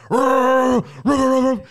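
high, loud mock dog growl; imitated barking. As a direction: bark like a bench of kids. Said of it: "they're all going".

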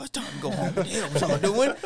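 loud scolding father with a laugh in it; hollering at the kids, half laughing; father yells at the kids to get in the car, loud; dad yelling. Retold or imitated. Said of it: "he was just like".

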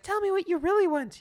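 put-on high, loud girlish voice; a mock lady's demand; imitating Katrina. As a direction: put on her prim voice. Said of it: "She's like".

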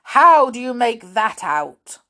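frightening loud, high demand; thundering. A narrator's reading. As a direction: thunder at the pupil. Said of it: "thundered Mister Grimm in a very frightening voice".

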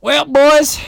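high lazy yokel voice; drunk cowboy drawl. Retold or imitated. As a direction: play a tipsy good ol' boy. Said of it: "be like".

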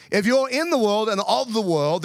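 usual speaking voice

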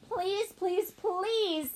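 drawn-out, high-pitched pleading; plaintive, insistent plea; begging. Acted. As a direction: beg and beg like a desperate kid. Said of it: "we all begged".

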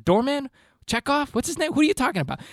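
grumpy neighbours asked for money, mock incredulous; annoyed neighbours. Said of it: "they're like".

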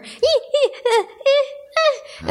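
high squealing ee moans; squeaky mock moans. Host imitating squealing moans, mock squeaks. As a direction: squeak it out, hammed up.

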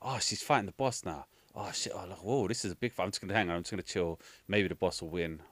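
low, soft commentary to oneself; bystander's inner monologue. Acted. Imagining the woman watching the fight, hushed low self-talk. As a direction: play the onlooker quietly biding their time. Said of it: "and she's like".

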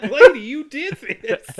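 exasperated retort. Retold or imitated. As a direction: lay the blame on her. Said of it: "It's like".

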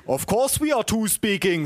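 put-on high, insistent German-accented English. A narrator's reading. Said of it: "sagte der Freund meiner Cousine". (Englisch mit deutschem Akzent, beharrlich)

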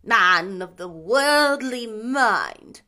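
slow, loud ghostly boom. A narrator's reading. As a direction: drag it out like a spirit from the grave. Ghost answers Scrooge.

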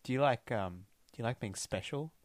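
hushed, secretive recruiter voice; stage whisper. Acted. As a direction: lean in and whisper the offer. Recruiting Anthony into Spectre.

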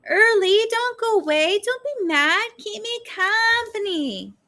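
put-on loud, very high child's wail; crying and calling out. A narrator's reading. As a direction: wail after someone who is leaving. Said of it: "Juby crying at the top of the stairs, calling".

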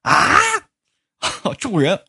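ordinary delivery, nothing marked

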